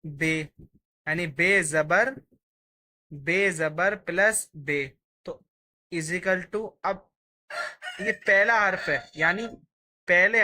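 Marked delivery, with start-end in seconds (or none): 0.0-4.9 slow, deliberate dictation of each letter; slow spelling out. Shown as a demonstration. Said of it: "teacher spells letters out". (अक्षर-अक्षर धीरे बोलना)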